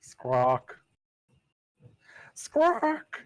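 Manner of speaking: shrill high bird cry; bird squawk. Acted. GM voicing a hawk cry outside the carriage, shrill squawk.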